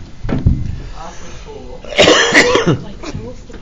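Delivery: long rattling chesty cough. Real, not acted. Teacher coughs.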